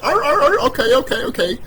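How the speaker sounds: high, loud grunting then meek okays; mocking dumb grunts. Mocking the grandparents' generation, high grunting voice. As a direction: play the sheep grunting and agreeing. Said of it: "you were like".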